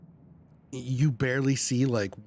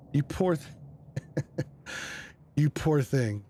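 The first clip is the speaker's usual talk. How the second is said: tender pity; much slower than usual. Acted. Player comforts the shivering possum, tender and slow. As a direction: coo over it like a cold pet.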